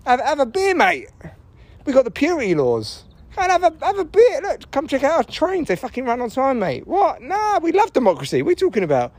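high, cheery mock German voice; breezy, dismissive Germans brushing off history. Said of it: "they were like".

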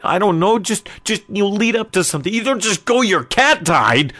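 high, loud, worked-up lecture; exasperated scolding. Acted. Man schooling brother on breaking bad news, exasperated.

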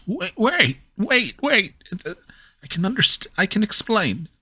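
put-on high, anxious pleading young man; nervous young man. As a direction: stammer it out in a panic. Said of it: "In the voice of a nervous young man".

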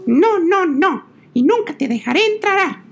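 a frightened piglet crying out; scared little pig. A narrator's reading. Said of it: "se despertó asustado y respondió".

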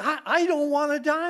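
put-on boy pleading with his uncle, high and loud; upset little boy. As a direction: let the child's fear spill over. Boy repeats, more upset.

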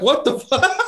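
high, baffled what the fuck; incredulous what the fuck. Retold or imitated. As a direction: pitch shoots up in shocked disbelief. Retold disbelief, laughing.

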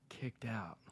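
whispered to himself. Real, not acted. Whispering while thinking of a kicked-out story. Musing over the question aloud.

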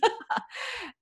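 high breathy laugh at herself; embarrassed laugh. Real, not acted. Laughing at her own tears while telling viewers.

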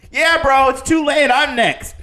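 high, loud mocking yell; gloating crew guy. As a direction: taunt him, next in line. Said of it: "Another dude comes out".

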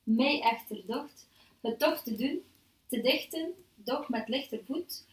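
slow, measured poetry recital; poem reading. Acted. Host reads the poem aloud to the panel, slow measured recital. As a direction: recite the verse deliberately, phrase by phrase.

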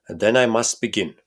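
put-on loud, proud nobleman with a German accent; a lightly voiced Bohemian count, pompous; hint of a German accent, grand and loud. Count's voice in read story.

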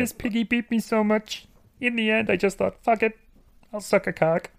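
high, far Kermit voice; squeaky frog puppet voice. Retold or imitated. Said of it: "my Kermit the Frog impression".

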